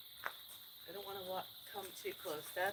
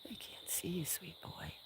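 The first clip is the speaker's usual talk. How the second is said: tender whisper, much softer; 2 semitones higher, 11 dB quieter than usual. Real, not acted. Owner reassures her sweet boy, tender whisper. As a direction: whisper it close so only he hears.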